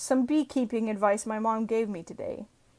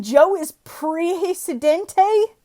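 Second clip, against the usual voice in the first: "Joe is Presidente" high, slow and loud, rising; questioning rise; 7 semitones higher, 8 dB louder, much slower than usual. Acted. Reader puzzling over a headline, "Joe is Presidente" as a drawn-out high question. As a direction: stretch "Presidente" into a big question.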